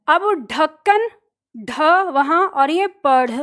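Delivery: slow ढक्कन then flapped पढ़; contrast ढ and ढ़. Shown as a demonstration. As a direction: set ढ against ढ़ slowly. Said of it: "अंतर आपको समझ में आ रहा है?". (ढ और ढ़ का अंतर धीरे से)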